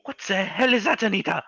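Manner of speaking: high, fretful granny voice; old grandma voice. Acted. Grandma asking Anita about the noise, high frightened voice. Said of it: "she's like".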